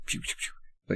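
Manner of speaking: soft whispery pew sounds; quick voiceless shooting noises; imitated arrow shots. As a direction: mimic arrows firing, softly. Imitating arrows being fired.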